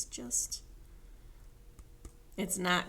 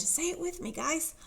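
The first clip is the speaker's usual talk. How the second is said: ordinary delivery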